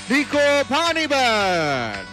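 usual speaking voice

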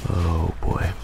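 ordinary talk, nothing marked

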